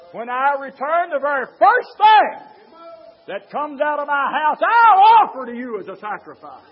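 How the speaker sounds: loud, high preacher's cry building on the vow; full-force preaching. Acted. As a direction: preach it out to the whole church.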